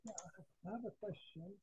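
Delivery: faint, far from the mic; barely audible. Real, not acted. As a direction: caller too far from the speaker. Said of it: "Your voice is very low".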